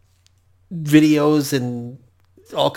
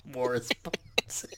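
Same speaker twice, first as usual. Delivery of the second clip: slow, soft villain voice; Bond villain; 13 dB quieter, slower than usual. Pretend. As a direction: purr the name menacingly. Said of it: "sound like a Bond villain".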